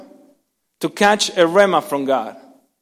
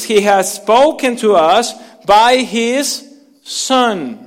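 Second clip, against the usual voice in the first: slow, louder scripture reading; 3 semitones higher, 4 dB louder, slower than usual. Acted. Preacher reading Hebrews to the congregation, slow and solemn. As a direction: read the verse slowly and solemnly. Reads scripture at sermon opening.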